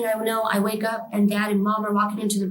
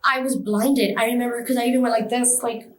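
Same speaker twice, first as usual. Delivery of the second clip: normal talking voice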